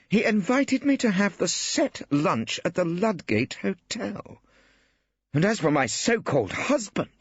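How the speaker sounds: cool sarcasm from a female barrister; dry lady barrister. A narrator's reading.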